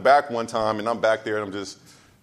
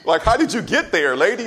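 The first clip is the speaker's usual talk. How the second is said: higher, laughing disbelief; mock startled; 6 semitones higher, 4 dB louder than usual. Preacher retells being startled by a church lady, mock bewilderment. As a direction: act out being caught off guard, amused. Retold startled reaction to sudden appearance.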